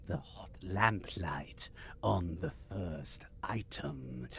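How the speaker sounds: slow, whispery reptile voice; Gorn. Acted. Host introducing the news to co-host in Gorn voice. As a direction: draw it out slowly like a lizard creature. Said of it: "I'll do my best Gorn voice".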